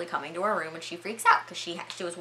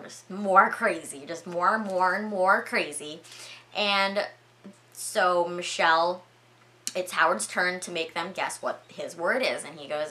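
ordinary delivery

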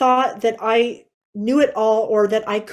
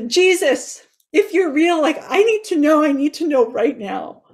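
higher, pleading cry to Jesus, a touch louder; desperate plea; 5 semitones higher, 3 dB louder than usual. Retold or imitated. Alone downstairs at night, bawling out to Jesus: raised desperate plea. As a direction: beg from the end of your rope, pitch raised.

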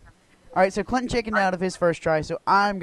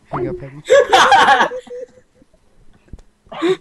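laughing; 10 dB louder than usual. Real, not acted. Laughing urgent request.

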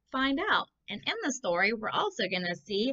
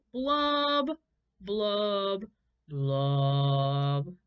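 sad blub blub; much slower than usual. Acted. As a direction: let each blub sink down. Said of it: "Let's say it".

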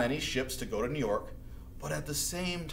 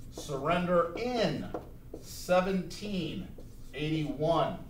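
slow, each word spaced for notes; slow dictation; slower than usual. Shown as a demonstration. Teacher dictating the date for notes, slowly. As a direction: dictate it so students can write. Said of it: "I wanna make it big so you can see".

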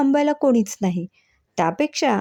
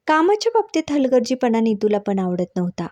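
usual speaking voice

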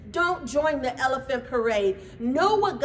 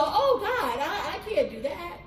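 high, half-laughing whimper; a lightly hammed-up nervous protest; mock timid excuse; 7 semitones higher than usual. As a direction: send up the scared believer. Mocking believers' excuses.

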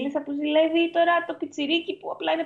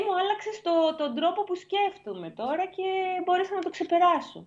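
quoting a fan; 6 semitones higher than usual. Retold or imitated. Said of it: "Μου λέει".

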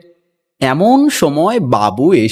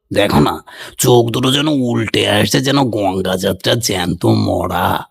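old uncle mocking; 6 semitones lower than usual. A narrator's reading. Old uncle mocks the portrait's eyes, in a lower old man's voice. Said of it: "এক একটা character আছে এখানে".

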